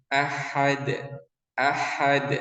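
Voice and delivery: usual speaking voice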